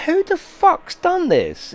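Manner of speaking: high, loud, slow disbelieving yell; slowed-down shocked high yell; retold furious outburst. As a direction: relive finding the smashed car, yelling. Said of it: "I was going mental".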